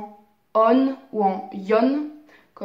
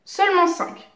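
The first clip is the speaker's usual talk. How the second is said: "Seulement cinq" stressed with a high peak; emphatic repeat; 7 semitones higher than usual. Shown as a demonstration. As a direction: hit "Seulement cinq" hard, insisting. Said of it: "il existe seulement cinq sons voyelles".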